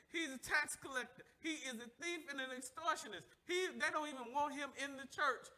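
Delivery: usual speaking voice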